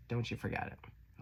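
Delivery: soft, breathy whisper; whispered reminder. Real, not acted. Reader's final reminder to the viewer, whispered. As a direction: hush it like a secret. Closing encouragement.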